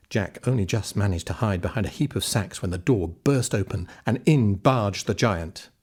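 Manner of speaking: ordinary delivery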